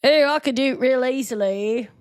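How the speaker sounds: cocky Liverpool boast, badly done; bad Liverpool accent. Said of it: "that's a bad Liverpool accent".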